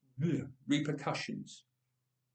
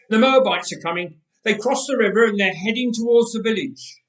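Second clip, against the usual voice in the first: shouting warning; 8 semitones higher, 10 dB louder than usual. Acted. Villager warning the village of Moabite raiders, shouting. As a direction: shout it out, higher and louder.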